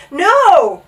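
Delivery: loud high shout of alarm. A narrator's reading. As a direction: shout to stop someone. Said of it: "shouted Bartholomew".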